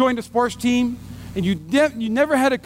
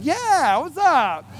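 eager reply; 4 semitones higher, much slower than usual. Retold or imitated. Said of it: "I was like".

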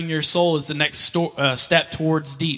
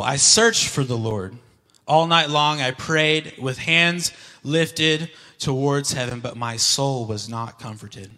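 slow scripture reading; 2 semitones lower, slower than usual. Acted. Rereads verse slowly.

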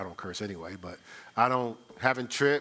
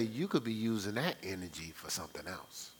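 softer, breathy imitation of a critic; whispered mocking quote; 8 semitones lower, 10 dB quieter than usual. Preacher whispers what mockers will say to the church. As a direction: whisper the critic's jab like gossip. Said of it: "People gonna say".

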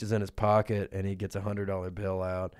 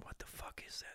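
hushed gossiping whisper; 19 dB quieter than usual. Acted. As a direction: whisper it like scandalised gossip in a crowd. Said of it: "there's already a whisper campaign going on".

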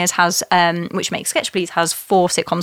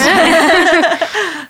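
high, loud burst of laughter; hearty laughing burst; 9 semitones higher, 6 dB louder than usual. Real, not acted. Laughing at venue appeal.

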